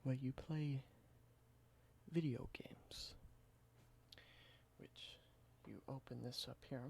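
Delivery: soft, close whispered ASMR roleplay. Acted. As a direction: whisper it gently like showing an old relic. Whispered ASMR show-and-tell roleplay.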